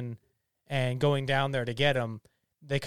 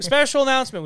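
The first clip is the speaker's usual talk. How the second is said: higher, louder announcer call; 8 semitones higher, 8 dB louder than usual. Shown as a demonstration. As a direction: announce it like breaking news. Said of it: "just do a special announcement".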